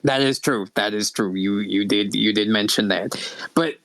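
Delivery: low, raspy admission; Lady Vera. Acted. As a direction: drop low and rasp, grudgingly conceding. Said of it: "really raspy voice".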